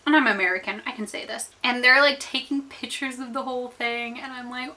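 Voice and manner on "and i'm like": ordinary talk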